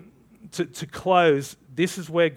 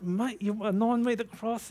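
higher, disbelieving mate; a nine-meter cross, seriously?; higher, disbelieving reply to God; incredulous; 6 semitones higher, 5 dB quieter than usual. Retold or imitated.

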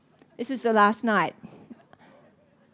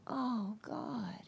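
much softer, near-crying; broken whisper; 14 dB quieter than usual. Retold or imitated. She realizes her mistake before God, softly near tears. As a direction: let it break as you realize.

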